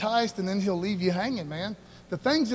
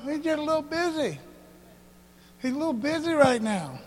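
mocking Elijah; 4 semitones higher than usual. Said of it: "He's like".

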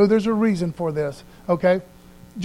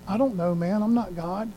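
ordinary talk, nothing marked

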